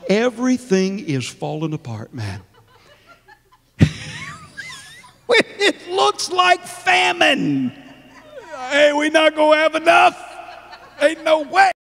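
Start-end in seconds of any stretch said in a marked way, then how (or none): 3.8-4.3 high burst of laughter; laughing. Real, not acted. Preacher laughing as he preaches. 5.3-11.7 high, shouted through laughter; laughing defiance. Real, not acted.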